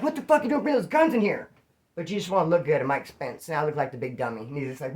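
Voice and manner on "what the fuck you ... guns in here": high-pitched mock outrage; worked-up, incredulous demand; indignant imagined outburst. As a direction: imitate someone scolding in disbelief. Imagined indignant reaction from accuser.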